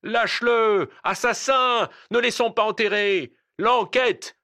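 high, loud mob shouts; angry crowd roar. Acted. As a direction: yell demands like an incensed crowd. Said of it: "gronda la foule".

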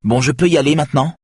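high, fast and clipped; barely holding anger in; contained anger; restrained voice. A narrator's reading. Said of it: "d'une voix contenue".

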